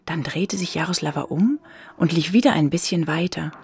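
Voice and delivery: plain reading voice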